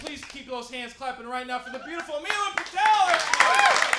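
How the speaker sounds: high, rising shout to the crowd; announcer intro. Real, not acted. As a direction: build to a big shouted introduction. Bringing up the next comic.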